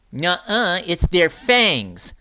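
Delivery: lisping through braces. Acted. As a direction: talk with a braces lisp. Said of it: "lisped Marcy through braces".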